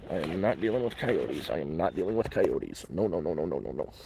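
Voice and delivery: low-pitched, tense insistence; real uneasy refusal. Real, not acted. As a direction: refuse repeatedly as you back away from coyotes. Coyotes pass close, he backs off.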